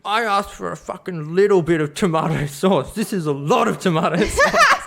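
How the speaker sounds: hammed-up outrage from a truckie; a bit of a gruff, annoyed truck driver; gruff angry trucker. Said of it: "this real gruff trucky guy".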